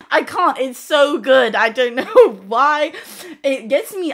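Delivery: laughing. Real, not acted. Gushing, laughing.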